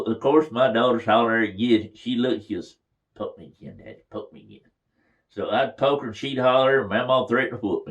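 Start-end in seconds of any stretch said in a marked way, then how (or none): 3.1-4.7 put-on soft, hushed child's plea; quiet child voice. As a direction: whisper it like a small girl.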